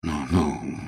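low, soft, clumsy comforting; awkward. Acted. Frederick consoles crying Jane, awkwardly. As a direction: mumble an embarrassed soothing word. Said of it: "неловко сказал он".

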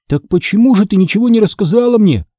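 high-pitched reproachful question; mildly outraged, high voice; indignant. A narrator's reading. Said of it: "возмутился".

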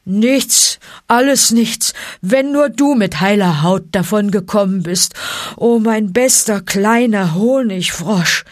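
gruff, emphatic, affectionate bear; grunting bear. A narrator's reading. Bear waves off wounds for his little frog, grunting. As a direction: grunt it with relieved fondness. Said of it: "grunzte Baloo".

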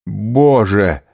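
sudden pained outcry; anguished cry. A narrator's reading. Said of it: "вскричал Дантес в душевной муке".

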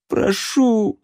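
whimpering high; plaintive whimper. A narrator's reading. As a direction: whimper it pitifully. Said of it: "жалобно хныкнул Алан".

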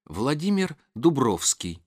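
ordinary delivery, nothing marked

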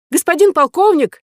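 very high, loud squeak; squeaky little man. Acted. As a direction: squeal like a tiny green man. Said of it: "пискнул зелёный человечек".

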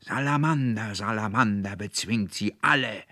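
high, loud raving bellow; enraged roar. A narrator's reading. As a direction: bellow it out, climbing on the last word. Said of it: "brüllte der Konrektor Paulmann in höchster Wut". (in höchster Wut gebrüllt)

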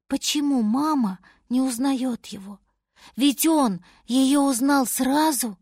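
usual speaking voice

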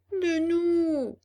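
high, loud, drawn-out wail; hammed-up pitiful moan; plaintive echo. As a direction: echo a beggar's whine. Cat echoes the fox's plea.

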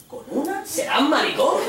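high-pitched mocking disbelief; hammed-up macho ridicule; incredulous scoff. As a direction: echo it back aghast, then jeer. Mate acted, scoffing at the confession.

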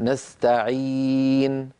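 نستعين recited slowly with a long ii; slow recital example. Shown as a demonstration. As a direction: stretch the ya in نستعين. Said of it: "ياء ساكنة قبلها كسرة". (تلاوة نستعين بمد الياء)

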